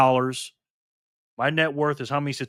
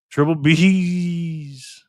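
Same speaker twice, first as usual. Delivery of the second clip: very slow, stretched, higher and louder nickname; drawn-out hype call; 5 semitones higher, 5 dB louder, much slower than usual. Real, not acted. Streamer greeting a viewer by nickname, drawn-out ring-announcer call.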